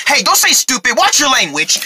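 usual speaking voice

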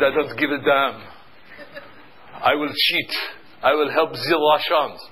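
put-on half-hearted French accent, unhurried; badly faked French accent. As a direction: attempt a French accent and miss. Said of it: "Bad accent".

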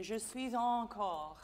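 slow, smooth "Je suis encore"; "Je suis encore" with no stop; model French. Shown as a demonstration. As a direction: say "Je suis encore" slowly, linked. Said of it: "Just say it for me".

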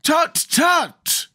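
high-pitched chiding tut; cautioning. A narrator's reading. Scarecrow cautions Jack, high and chiding. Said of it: "cautioned the Scarecrow".

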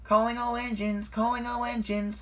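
very high, carrying announcement; high sing-out summons; calling out. A narrator's reading. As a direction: call everyone in with a high, loud voice. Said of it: "called Mavis".

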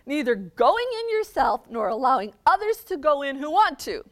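loud, high, railing at the Pharisees; angry Jesus. Acted. Jesus raging at the scribes and Pharisees, loud and high. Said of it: "I love to picture Jesus this way".

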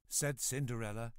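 ordinary delivery, nothing marked

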